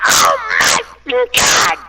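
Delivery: drawn-out hoarse mock scream; retold scream. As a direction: scream it out slowly, imitating her meltdown.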